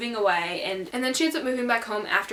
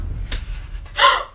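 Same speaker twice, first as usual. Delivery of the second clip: sharp gasp; 4 dB louder than usual. Real, not acted. Catches own accent, gasps.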